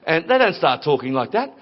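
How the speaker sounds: ordinary delivery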